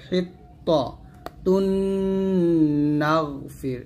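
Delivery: long hummed n at the end of "হিত্তাতুন"; held ghunnah. Shown as a demonstration. Teacher modelling for learners, "হিত্তাতুন" with a slow held nasal. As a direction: stretch the nasal of "হিত্তাতুন" before moving to "নাগফির". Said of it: "এরকম ভাবে".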